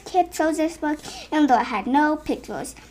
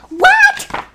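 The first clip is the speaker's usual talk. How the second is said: shocked squeal; 11 dB louder than usual. Acted. Shocked at the book's words.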